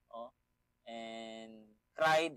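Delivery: ordinary delivery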